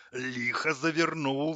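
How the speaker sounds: slow, slightly choked voice; strangled complaint. A narrator's reading. Tap complains of being shut tight, mildly strangled. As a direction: complain as if lightly throttled, drawn out. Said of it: "сдавленно пожаловался кран".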